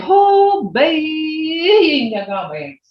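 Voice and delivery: a hammed-up, theatrical gasp of amazement; dramatic exclamation. Shown as a demonstration. Teacher acts out a dramatic reaction to show tone, very high then dropping. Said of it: "if I really wanted to get dramatic".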